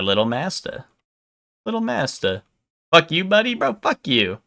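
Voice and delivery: soft, lightly imitated Southern accent; mock a Southern attendant, voice lifting. Gamer imitates the kid's Southern attendant, Southern accent. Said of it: "has a Southern accent. She's like".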